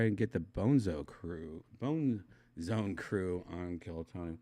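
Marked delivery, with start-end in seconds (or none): none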